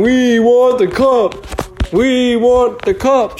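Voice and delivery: high, drawn-out imitated chant; Kyle yelling. Roommate yelling for the cup, imitated high chant. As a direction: mimic a screaming sports fan, slow and high. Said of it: "Kyle's yelling and screaming".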